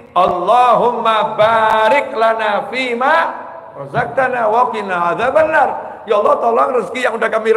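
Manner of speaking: high, loud preaching prayer; fervent prayer. Acted. Preacher leading the meal prayer at full force. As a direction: pour out the prayer with fervour.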